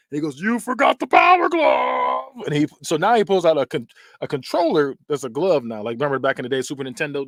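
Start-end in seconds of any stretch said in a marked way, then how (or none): 0.4-2.3 loud, high, drawn-out taunt; hammed-up villain's triumphant cry; mock villain shout. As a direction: bellow it slowly, stretching the last word.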